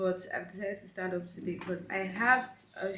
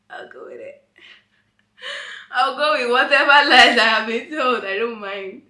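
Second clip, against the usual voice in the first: laughing; 4 semitones higher, 17 dB louder than usual. Real, not acted. Laughing at compliment on voice.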